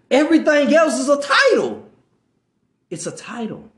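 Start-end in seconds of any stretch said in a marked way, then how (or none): none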